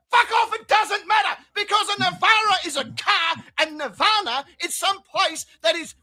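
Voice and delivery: mock furious yell; angry shout. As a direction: yell back in irritation. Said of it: "you'll be like".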